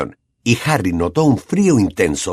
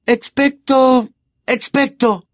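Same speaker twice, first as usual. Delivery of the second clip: faltering high spell; 14 semitones higher, slower than usual. A narrator's reading. Hermione failing the spell.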